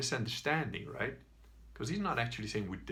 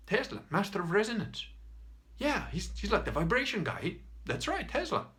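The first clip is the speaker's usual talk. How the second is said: higher, animated, delighted recognition; excited agreement; 9 semitones higher, 3 dB louder than usual. Acted. Bald man bonding with shouty man over Tesla, excitedly. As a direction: gush about a shared hero, pitch leaping up.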